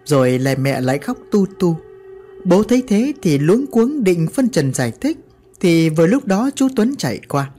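plain reading voice

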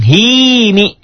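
"Himi" sung high with a lengthened i; long i. Shown as a demonstration. Teacher showing the long vowel to students, high. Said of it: "ya-nya sebagai pemanjang".

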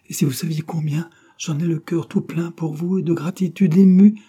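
high, swelling outpouring of emotion; gushing gratitude. A narrator's reading. Sir Felton pours out his gratitude to Laure, gushing. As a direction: build to a loud, heartfelt peak.

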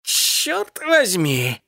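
high, very drawn-out cat exclamation; aroused cat's slow exclamation; exclaiming. A narrator's reading. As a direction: stretch it out high. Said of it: "воскликнул он".

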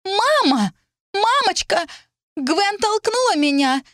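put-on very high, loud child's wail; wailing scream. A narrator's reading. Hurt Lilith calls her mother, screaming. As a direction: scream for mother, stretching the accusation. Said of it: "вопила Лилит".

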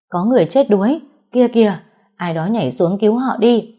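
high, loud panicked calls; alarmed crowd shout. Acted. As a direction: shout that someone is drowning. Crowd cries drowning alarm.

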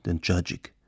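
ordinary delivery, nothing marked

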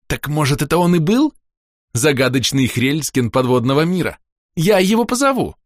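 put-on eager, high-pitched girl; excited child. A narrator's reading. Daughter offers to call the mysterious creature, excited and eager. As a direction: build to a high, eager announcement.

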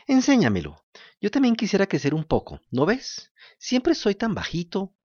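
put-on high, eager wooden-puppet boy; a thirsty puppet boy begging politely. To the kind woman, Pinocho asks for water in a high puppet voice.

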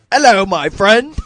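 high, bright-eyed British put-on greeting; mock British accent. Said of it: "I'll talk like the biggest fucking howly".

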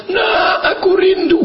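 high mock motherly call; imagined longing call. Imitates mother calling on telephone. (meniru ibu memanggil rindu, melengking)

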